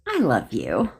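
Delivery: loving. Shown as a demonstration. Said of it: "I'll give you an example".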